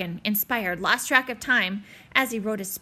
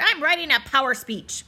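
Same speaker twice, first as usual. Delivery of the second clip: hollering; 4 semitones higher, 5 dB louder than usual. Acted. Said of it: "Barkin hollered".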